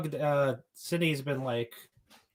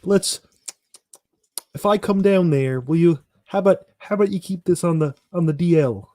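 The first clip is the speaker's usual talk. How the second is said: louder, conspiratorial Kermit; 5 dB louder than usual. Pretend. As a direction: Kermit cutting a shady deal. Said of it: "Pennywise luring Kermit".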